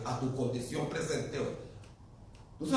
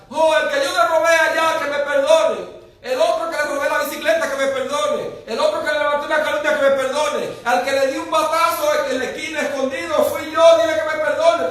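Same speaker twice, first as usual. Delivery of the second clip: loud, higher, hammed-up pleading shout; mock shouting thief; 9 semitones higher, 9 dB louder than usual. Preacher imitating the thief on the cross yelling to everyone he wronged, mock shout. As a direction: play the thief yelling for pardon, half in jest. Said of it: "se puso a gritar".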